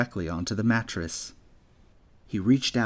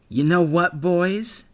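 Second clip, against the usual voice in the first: loud, higher taunting voice; jeering bully; 8 semitones higher, 7 dB louder, slower than usual. Acted. Bully Gale mocking Jack's superhero dream, loud jeering. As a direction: toss out insulting names with glee. Bully rallies his gang.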